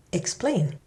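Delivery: "Explain" as ex-PLAIN; stress on second syllable. Shown as a demonstration. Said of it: "stress two-syllable verbs on the second syllable".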